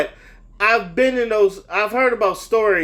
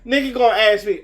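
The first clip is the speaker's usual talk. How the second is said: ordinary delivery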